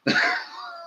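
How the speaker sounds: a sudden high-pitched laugh; high squealing laugh. Real, not acted. Laughing at his own vegetable story, high and squealing.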